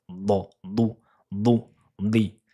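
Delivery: ض ضو ضي with the heavy emphatic dad; teacher drilling the letter ض. Shown as a demonstration. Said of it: "Attention à la lettre ضاد".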